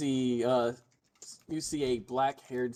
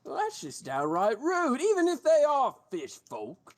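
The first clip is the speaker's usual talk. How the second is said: higher, louder indignant guard; mild hammed-up guard indignation; 7 semitones higher, 4 dB louder than usual. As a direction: huff with mock outrage at the rudeness. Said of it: "he's just like".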